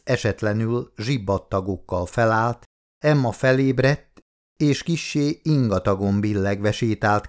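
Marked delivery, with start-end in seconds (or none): none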